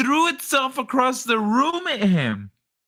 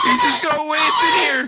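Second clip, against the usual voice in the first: squeaky, much higher yelled imitation, cracking with laughter; mock high-pitched panic; 4 semitones higher, 5 dB louder, faster than usual. Mocking the panicked man in the clip, high-pitched laughing yell. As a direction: shriek it like the scared guy, laughing through it. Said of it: "I lost it when he went high pitched".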